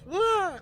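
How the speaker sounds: sudden high yelp of alarm; a sharp cry as he falls; startled cry. Acted. Skullface falls, cries out.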